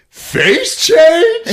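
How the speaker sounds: very high, loud question; mock incredulous shout. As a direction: echo it in hammed-up disbelief. Incredulous reply.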